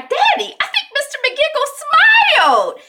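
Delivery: put-on high, loud, excited little-girl voice; excited child. Annie tells Daddy about her lizard, excited child. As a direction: imitate a kid calling out gleefully.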